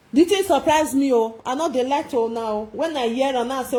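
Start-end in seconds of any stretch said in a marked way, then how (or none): none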